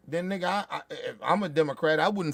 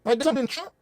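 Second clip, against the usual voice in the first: ordinary delivery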